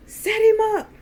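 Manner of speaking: high, loud shouted command; barked order. Retold or imitated. Uncle ordering his men to lift the beaten man, barking. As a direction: imitate a man bellowing orders. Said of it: "Earl just started barking orders".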